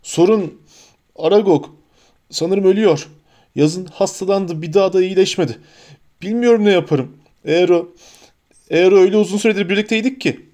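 acted grief, stumbling over the words; tearful. A narrator's reading. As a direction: speak as if choking back tears. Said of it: "aniden gözyaşı dökmeye başladı".

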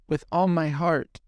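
put-on high, earnest girlish vow; devoted young mermaid. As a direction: promise it high and sincere. Mermaid agrees to the deal.

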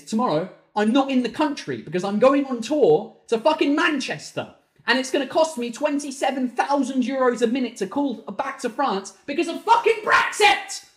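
high, loud rant building to a shout; tantrum. Real, not acted. Ranting about costly calls from Manchester, tantrum. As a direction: climb to an exasperated yell. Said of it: "Tantrum over".

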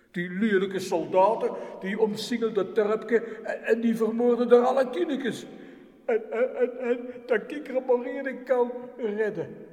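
loud, high, anguished telling; the guardian angel in anguish over the children; distressed cry. Acted. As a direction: cry it out in horror. Angel sees massacre, distressed.

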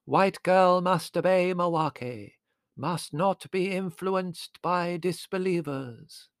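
high, very slow intoned commands; séance medium. A narrator's reading. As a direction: chant it out like a spirit medium. Medium intones spirit's orders.

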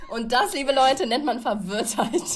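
loud, laughing mock presenter; mock announcement. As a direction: announce it like a gameshow host. Jokey announcement after slip.

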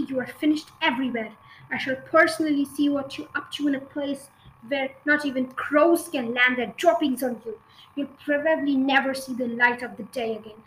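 rattled off fast, spitting rage; fast rant. Acted. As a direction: race through the threat. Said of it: "ranting like maniac".

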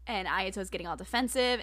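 ordinary delivery

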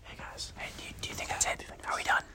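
breathy whisper right into the camera; whisper, fast on the last question. Pretend. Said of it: "Why are we, why are we whispering".

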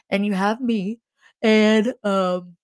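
words stretched by a yawn. Real, not acted. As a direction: talk through a yawn. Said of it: "sorry for the yawning".

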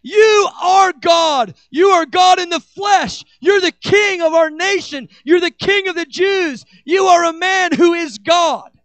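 loud, high proclaiming cry; shouting acclamation. Retold or imitated. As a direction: proclaim it like a crowd hailing a king. Crowd declaring Jesus Messiah.

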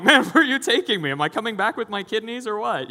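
high, loud incredulous questioning; comic, worked-up protest; mock alarm. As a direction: playfully panic about where you're being taken. Acted alarm at mysterious car trip.

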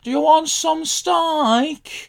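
high-pitched, drawn-out Brummie impression; Brummie accent. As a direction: imitate a Brummie butcher, very high and slow. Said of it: "who was a Brummie".

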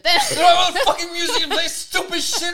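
high, loud laughing complaint; complaining about the ride music while laughing; laughing rant. Real, not acted. Laughing exasperation.

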